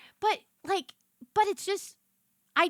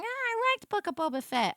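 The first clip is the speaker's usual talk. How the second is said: higher, dopey mock fan voice; lightly mocking a fan; 8 semitones higher than usual. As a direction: parody a clueless fan. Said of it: "you're like".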